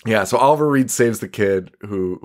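ordinary delivery

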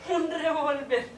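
slow, weighed statement of the word; slow flat repeat. Acted. Woman on the phone repeating her lover's word slowly. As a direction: let the word sink in slowly.

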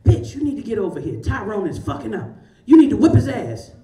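a fired-up friend calling for backup; mock yell from an angry best friend; demanding best friend. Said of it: "she'll be like".

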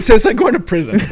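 loud, amused, laugh in the voice. Real, not acted. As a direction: say it while cracking up. Cracking up at own example.

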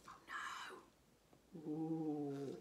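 worried whispered aside; hushed whisper. Real, not acted. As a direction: breathe it in dread.

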